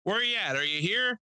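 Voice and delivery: high, sing-song host voice calling out; old lady host. Retold or imitated. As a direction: call him up like an ancient emcee. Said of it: "She goes".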